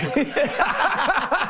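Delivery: a high burst of real laughter at the memory; amused laugh. Real, not acted. Ducking under the table story.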